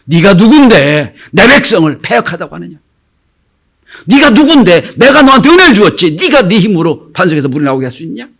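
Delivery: angry, loud challenge, pitch leaping; God. Acted. God confronts Moses over the rock, angry. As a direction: rebuke with acted indignation, hammering the questions. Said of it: "하나님 들으시고 화내세요".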